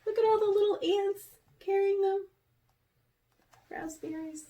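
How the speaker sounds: high, quick pointing out; delighted aside. Real, not acted. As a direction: marvel at the picture. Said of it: "aside to child listener".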